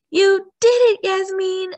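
high, loud, thrilled cheer. A narrator's reading. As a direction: cheer for a teammate's success. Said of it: "Emma cheered".

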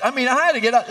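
usual speaking voice

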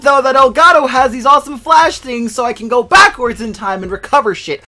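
loud, high-pitched frustrated venting; exasperated rant. Real, not acted. Angry rant over recording mishap.

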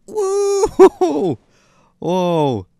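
loud high amazed whoa; excited whoa. Real, not acted. Crash surprise.

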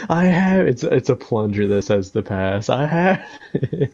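loud, laughing through words. Real, not acted. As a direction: crack up mid-sentence. Laughing while telling joke.

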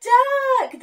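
usual speaking voice

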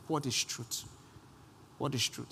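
a hint of a weary, doubting Pilate; preacher dropping into a quiet Pilate voice; voicing Pilate.

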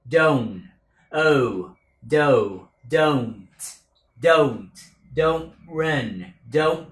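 "Don't" ending in a catch in the throat on the t; throat-stopped t. Shown as a demonstration. Teacher demonstrating the choked t in don't. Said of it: "Có cái nghẹn ở cổ cho âm T".

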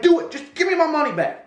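high-pitched, exasperated demand; acted-out yelling, voice pushed high; aggravated yelling. Yelling at the money to work, aggravated. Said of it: "I'm yelling at the money".

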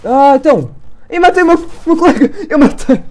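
high, loud startled exclamation; shocked disbelief. Real, not acted. Player realises he shot his own teammate, shocked. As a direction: blurt out the shock of a teamkill.

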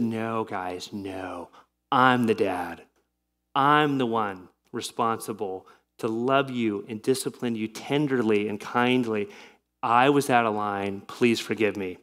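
soft, low, gentle confession; tender apology. Retold or imitated. Father tenderly apologizing to his children, soft and low. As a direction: quietly say sorry to your kids.

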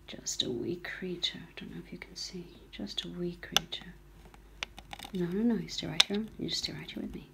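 soft, calming murmurs; hushed soothing whisper. Real, not acted. Rescuer soothes a goldcrest perched on her finger, hushed. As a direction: keep it barely voiced to settle the bird.

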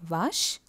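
French "vache", said vash; French pronunciation. Shown as a demonstration. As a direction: say "vache" ending in sh. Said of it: "ஃப்ரெஞ்சில்".

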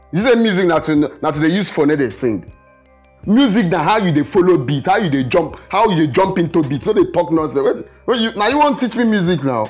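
loud, high-pitched indignant rant; angry outburst. Acted. Kidnapper scolding captive who mocked his music, angry. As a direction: tear into the critic.

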